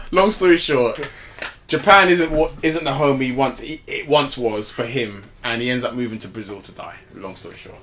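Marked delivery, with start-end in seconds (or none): 0.1-1.1 laughing summary. Real, not acted. Sums up story, laughing. 7.1-7.8 quiet deadpan. Real, not acted. Repeats summary, deadpan.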